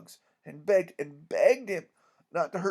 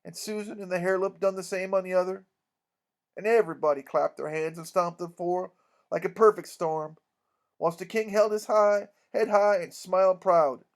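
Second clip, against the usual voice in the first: ordinary delivery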